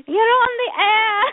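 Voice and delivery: high, loud drawn-out mock yell; imitated yelling. Imitating guest's husband yelling across the house, mock yell. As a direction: call it out slowly like a yelling husband. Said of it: "he was yelling to her".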